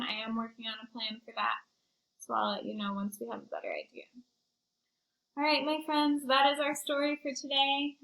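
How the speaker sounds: plain reading voice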